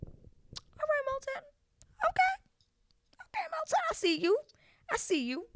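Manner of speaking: very high, playful teasing voice, fading to a whisper; teasing approval. Retold or imitated. Vlogger reacting to Milton's line, high and teasing. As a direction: tease him like you're impressed. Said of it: "And I said".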